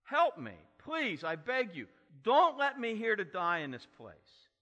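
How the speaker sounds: loud, high frantic begging; screaming bloody murder. Retold or imitated. Joseph begging his brothers from the pit, loud frantic scream. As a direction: cry out for your life. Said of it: "he's screaming bloody murder".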